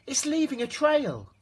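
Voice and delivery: put-on high, loud kids pointing; excited children calling out. A narrator's reading. Children point at the trail, high and loud. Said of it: "say the children".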